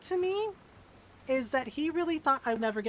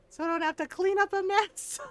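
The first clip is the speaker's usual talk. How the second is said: higher, laughing aside; 9 semitones higher, 4 dB louder than usual. Real, not acted. Joking to viewers while laughing. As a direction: laugh as you say it.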